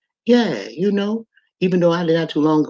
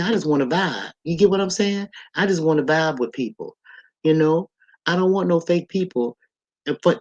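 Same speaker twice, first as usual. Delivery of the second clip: usual speaking voice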